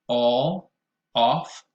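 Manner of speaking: "all" and "off" said slowly with open rounded aw; teacher models "all" and "off" clearly; modelled vowel in all and off. Shown as a demonstration. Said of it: "repeat them after me".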